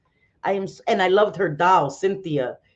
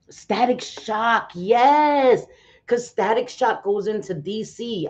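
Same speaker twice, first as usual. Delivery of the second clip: high, drawn-out fan cheer; slow build to a loud, high "yes"; real excitement; 6 semitones higher, 3 dB louder, slower than usual. Real, not acted. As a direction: cheer for a beloved show. Fan reacting to favorite show.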